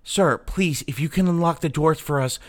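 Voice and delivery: high, urgent begging plea; desperate pleading clerk. Acted. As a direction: beg to be let home. Data clerk pleads to boss.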